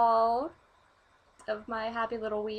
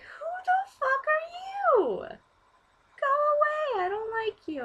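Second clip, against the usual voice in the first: high, loud, slow disbelieving shriek; inner outrage; 4 dB louder than usual. Retold or imitated. Silently to the creepy stranger, outraged shriek. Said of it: "inside I'm like".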